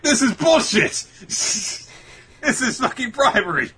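high, loud hammed-up indignation, laughing; mock outrage. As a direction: fake fury at a cake bribe. Boys outraged by cake bribe.

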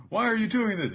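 high, loud mock wail; hammed-up distressed heroine; upset Baby Five. Acted quarrel, upset Baby Five.

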